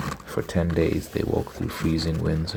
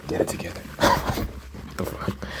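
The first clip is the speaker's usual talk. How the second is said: breathy laugh through tears; near-tears chuckle; teary laugh. Real, not acted. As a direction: laugh it off, half-whispered. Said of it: "why do I wanna cry".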